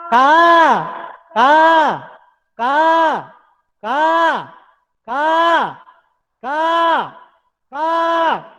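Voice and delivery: high, loud caws; crow caw. Shown as a demonstration. Yoga teacher shows the crow's cry, loud high caws. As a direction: caw like a crow with full throat. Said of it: "How does the crow cry?".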